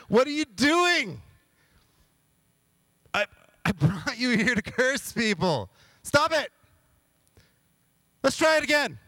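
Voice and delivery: high, loud frantic squeal; losing his mind. Retold or imitated. King frantic at Balaam for blessing again, high loud squeal. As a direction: scream at the prophet to stop. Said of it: "Balak is losing his mind".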